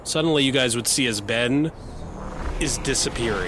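loud, urgent scene setting; dramatic narration. Acted. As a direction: build the danger for the table.

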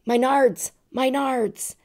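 high, pained yelp; son in pain. Retold or imitated. Hurt son after hitting the fence, high pained cry. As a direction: whimper it up high like a hurt kid. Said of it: "and he said".

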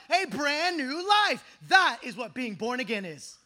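loud, pounding, slowing on "a brand new life"; full-force preaching. Real, not acted. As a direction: hammer home the new life.

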